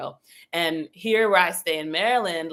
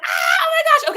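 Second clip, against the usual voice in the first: startled squeal; 8 dB louder than usual. Pretend. Angels startled she's awake.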